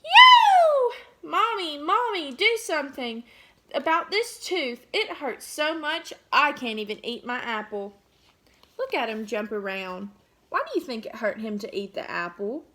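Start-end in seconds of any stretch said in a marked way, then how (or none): 0.0-1.3 huge high laughing yell; big laughing shout of pain. Acted. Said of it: "and yelled". 1.3-8.0 hammed-up cry for help; yelling. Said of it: "and yelled".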